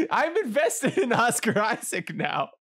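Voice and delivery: talking through laughter; high laugh spilling into slowed words; helplessly amused protest. Real, not acted. Cracking up during failed search.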